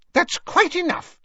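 high, sharp reproof; sharply scolding in a high voice. A narrator's reading. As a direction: cut in as an affronted shopkeeper, high-pitched. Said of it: "said Madame Malkin sharply".

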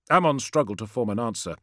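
ordinary delivery, nothing marked

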